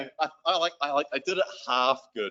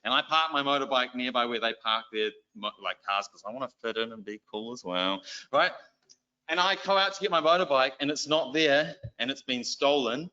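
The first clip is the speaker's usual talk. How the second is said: ordinary delivery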